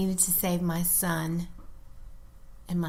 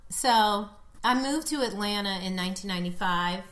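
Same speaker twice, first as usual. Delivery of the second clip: ordinary talk, nothing marked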